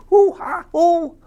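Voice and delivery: very high, loud hoo-ha monkey hoots; monkey call. Acted. Reading to a toddler, the monkeys call out in high hoots. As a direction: squeal it out like a cheeky monkey.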